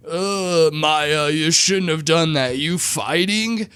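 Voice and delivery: put-on loud, slurring drunk father scolding; mock drunk dad scolding his daughter. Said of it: "and he looks drunk".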